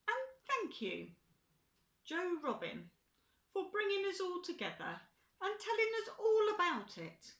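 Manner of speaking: lifted high, hooting owl warmth. A narrator's reading. Ernest Owl thanking Joe Robin, high and loud. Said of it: "Ernest Owl spoke out".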